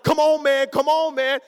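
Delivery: frantic urging. Retold or imitated. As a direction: shout him on in mock alarm. Urging brother out of danger.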